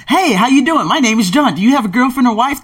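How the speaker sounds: mock nosy stranger. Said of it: "you just don't ask right off the bat".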